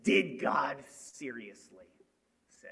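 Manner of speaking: a hint of a sly serpent, slow and low; sly snake. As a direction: draw out the question like a tempting snake. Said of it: "what's his question?".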